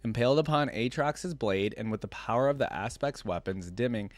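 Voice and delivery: usual speaking voice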